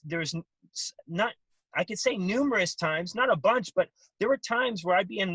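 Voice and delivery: normal talking voice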